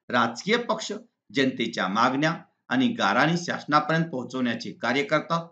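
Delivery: normal talking voice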